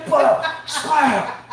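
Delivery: high-pitched slap sound words; mock slap yell. Dad pretending to hit back for his daughter, mock slap yell. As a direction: punch out each slap.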